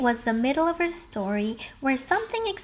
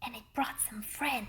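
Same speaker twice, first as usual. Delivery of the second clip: hushed story whisper; 2 semitones lower, 11 dB quieter than usual. A narrator's reading. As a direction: breathe it out in a whisper. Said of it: "narrator whispers".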